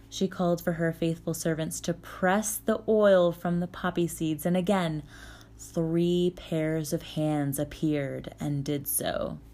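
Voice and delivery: usual speaking voice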